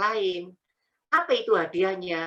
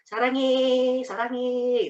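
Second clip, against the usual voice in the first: playful Korean imitation; 5 semitones higher, much slower than usual. Playfully mimics Korean love words.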